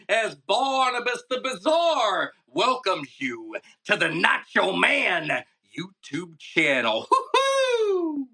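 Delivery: booming high carnival barker; snake oil seller. Acted. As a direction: shout it like a sideshow huckster. Carnival pitch welcoming viewers.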